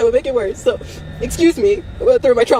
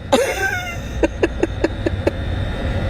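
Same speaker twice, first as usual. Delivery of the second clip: crying laugh; 5 dB quieter than usual. Real, not acted.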